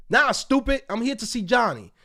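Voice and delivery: loud, fed-up comeback; exasperated retort. Retold or imitated. To the receptionist, loud exasperated comeback. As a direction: cut her off, annoyed.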